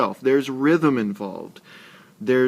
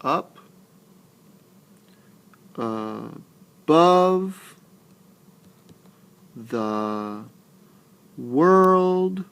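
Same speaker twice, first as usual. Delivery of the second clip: very slow syllable by syllable, "above" split with its end raised; stressed syllables demonstrated; much slower than usual. Shown as a demonstration. Teacher leading students through the rest, alternating stress. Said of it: "that I'm stressing, putting emphasis on".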